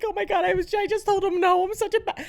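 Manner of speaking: high-pitched, whiny self-blame; mock crying. Mother retelling guilt over telling child no, mock tearful whine. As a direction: blubber it high, hammed-up. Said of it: "then I'm fucking crying".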